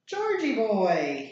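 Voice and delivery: slow, loud drawn-out taunt; bully's slow sneering yell. Acted. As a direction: call him out mockingly. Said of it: "the yell".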